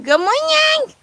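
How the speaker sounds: soar up high on morning; high sing-song good morning; high singsong greeting. Acted. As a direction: trill it way up like a cheery bird. Sung greeting.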